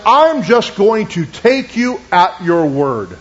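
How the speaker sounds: very slow, word by word; deliberate declaration. Retold or imitated. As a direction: state it to God slowly and firmly. Retold resolute prayer of faith.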